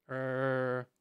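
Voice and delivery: low, far-off zombie groan. Pretend. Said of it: "that's my best zombie impression".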